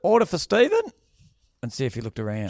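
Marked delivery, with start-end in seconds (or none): 0.0-0.8 high, loud order announcement; mock counter call-out. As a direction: call a name across a restaurant. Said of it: "Do they yell out names".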